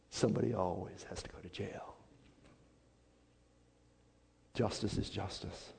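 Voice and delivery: very soft, low retold officer's reply; hushed officer. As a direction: drop to a grave near-whisper as the officer. Acted officer answering Ben gravely.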